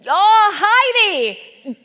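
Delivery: loud, high, drawn-out hailing shout; high-pitched hollered greeting; builder's shout. Retold or imitated. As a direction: bellow a long greeting like a builder across roadworks. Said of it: "this builder shouted at me".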